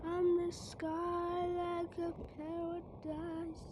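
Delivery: high, drawn-out wailing; slow, high-pitched play-acted despair; mock falling wail. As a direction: stretch the words out slowly like a long fall. Acted fall from the sky.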